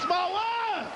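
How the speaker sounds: put-on a kid hollering on the ride; kids shouting. Bebe kids yelling on the ride, high shout.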